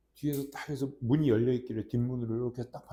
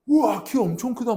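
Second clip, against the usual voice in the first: put-on high, louder awed gasp of a kid; amazed kids; 9 semitones higher, 8 dB louder than usual. Crowding kids marvel at tall dad, awed voice. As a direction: marvel at his height like a child. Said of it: "막 하면서".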